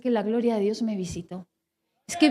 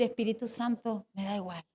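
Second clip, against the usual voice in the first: quiet surrender; 5 semitones lower, 9 dB quieter than usual. Retold or imitated. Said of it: "Y lo logré decir".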